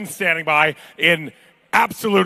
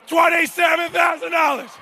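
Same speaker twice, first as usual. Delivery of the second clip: shouted bid call; 11 semitones higher than usual. Acted. Auctioneer calling the bid.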